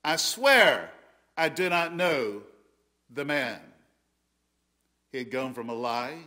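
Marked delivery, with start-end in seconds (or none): 0.0-3.6 loud, insistent swearing; a loud, pleading oath of denial. Retold or imitated. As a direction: swear it desperately, the first swears loudest. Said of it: "it's like he's saying".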